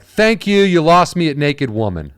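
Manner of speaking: mock judge dismissal. Judges cutting the comic off, mock loud. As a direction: brush the comic off loudly. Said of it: "they were like".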